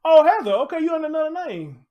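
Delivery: loud, high exclamation of recognition; surprised recognition. Real, not acted. Streamer recognising Heather under another name, surprised recognition. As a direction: cry it out high and loud as it dawns on you. Recognises renamed viewer in chat.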